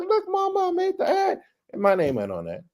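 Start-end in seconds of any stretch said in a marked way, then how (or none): 0.0-1.4 put-on very high, kid-like voice calling to mom; like a proud little boy running home; childlike joy.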